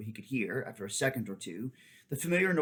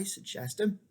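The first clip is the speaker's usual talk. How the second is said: ordinary delivery, nothing marked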